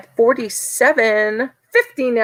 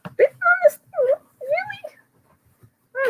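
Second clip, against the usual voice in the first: high, whiny cry of disbelief, much higher and softer; mock shock; 4 dB quieter than usual. Host mimicking her shock that a bidder skipped out, whining high. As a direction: squeal it like you can't believe it. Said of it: "I'm like".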